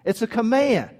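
usual speaking voice

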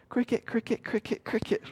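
soft, high cricket chirps; imitating crickets. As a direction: chirp it quietly into an awkward silence. Imitates crickets in an awkward silence.

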